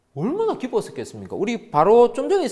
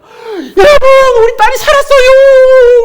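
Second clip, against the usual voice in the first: screamed out, much higher and louder, drawn out; joyful shout; 20 dB louder, much slower than usual. Retold or imitated. Preacher voicing Jairus shouting to the crowd, ecstatic yell. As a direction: shriek the news like an overjoyed father. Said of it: "막 소리 막 질렀을 것 같아요".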